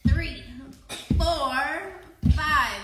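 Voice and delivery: loud, punched count. Real, not acted. Teacher counting kids' toad hops, calling out loudly. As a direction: belt out each number for the hops.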